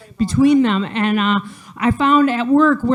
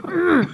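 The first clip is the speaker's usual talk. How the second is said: gruff mock grunt; butch grunt; 7 semitones higher than usual. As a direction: grunt like a tough guy.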